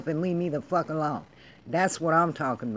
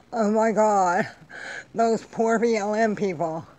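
higher-pitched, sarcastic lament; mock crying; 3 semitones higher than usual. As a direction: wail in mock sympathy for the victims.

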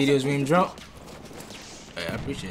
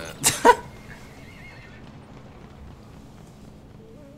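high breathy laugh; 3 dB quieter than usual. Real, not acted. Laughs at own death.

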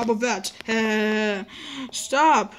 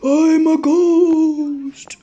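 very slow, higher and louder spooky ghost moan; 4 semitones higher, 7 dB louder, much slower than usual.

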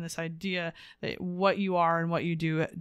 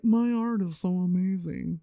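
a hint of a pretentious artist; lightly mock snob boasting; snobby art voice. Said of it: "that's my snobby art voice".

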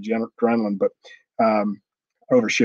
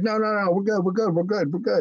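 high, hurried imitation of backing down; Ric Flair begging off; 7 semitones higher than usual. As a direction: plead in a raised pitch, repeating to placate. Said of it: "he starts begging off".

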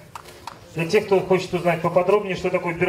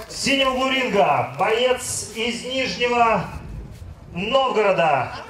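drawn-out ring announcer call; boxing announcer; 3 semitones higher, slower than usual. Acted. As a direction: stretch the names like a ring announcer. Ring announcer introduces fighter.

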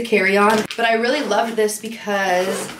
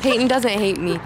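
ordinary talk

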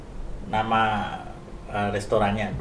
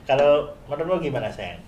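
normal talking voice

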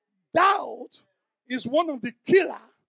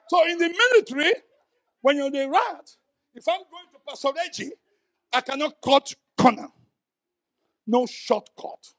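ordinary talk, nothing marked

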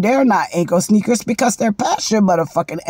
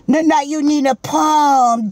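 ordinary talk

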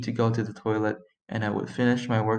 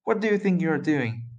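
high, raised scolding yell; 6 semitones higher, 4 dB louder than usual. A narrator's reading. Boss scolding his worker over the crash, yelled. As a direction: call him out sharply by name. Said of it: "yelled the boss".

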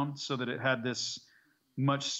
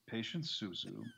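low, slow menacing pyromaniac; a hint of a deep-voiced villain; 3 semitones lower than usual. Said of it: "I remember one of my lines was".

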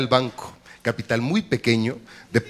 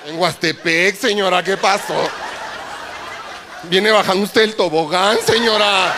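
pool-goer voice; 6 semitones higher, slower than usual. Retold or imitated. Pool-goer complaining at water birth.

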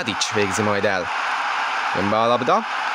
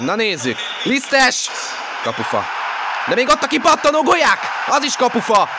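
excited hungarian sports commentary; 12 semitones higher than usual. Real, not acted. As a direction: yell each near-miss. Penalty, rebound, double post.

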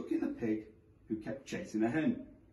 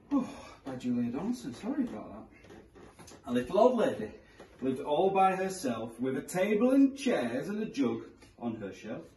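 ordinary delivery

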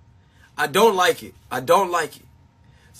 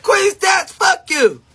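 high, louder mock yell at a friend; mock shout; 11 semitones higher, 4 dB louder, slower than usual. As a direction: fling it at her like a playful curse. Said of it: "I be like".

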